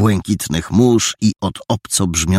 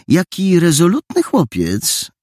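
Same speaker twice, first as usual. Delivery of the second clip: put-on higher delighted exclamation from an old lady; exclaiming lady; 11 semitones higher than usual. Silver-haired lady praises the boy, higher and delighted. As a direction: gush over the clever boy. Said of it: "zawołała".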